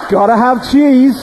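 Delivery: high laughing delight; real laughter at his own joke. Real, not acted. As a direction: laugh through the words.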